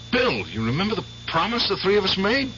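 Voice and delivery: high, quickened insistence; urgent pressing. Acted. Eddie presses Bill about their old promise, urgent.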